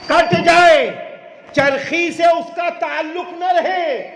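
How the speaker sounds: high, loud and drawn out at the climax; preacher's shout. Acted. Preacher shouting to the congregation at the climax. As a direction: cry it out to the crowd.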